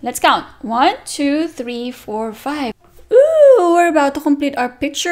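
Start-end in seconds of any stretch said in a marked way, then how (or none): 3.1-3.7 high, loud ooh; excited ooh. Acted. Teacher exciting kids near the end of the picture. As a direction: build excitement.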